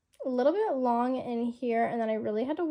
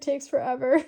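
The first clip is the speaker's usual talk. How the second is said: ordinary talk, nothing marked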